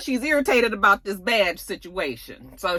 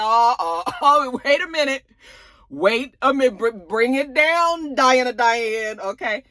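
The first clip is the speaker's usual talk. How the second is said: mock calming down; 6 semitones higher than usual. Narrator tells Diana to calm down.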